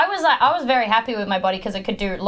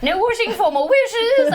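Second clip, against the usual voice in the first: high character voice; 11 semitones higher, 3 dB louder than usual. Acted. Player in character.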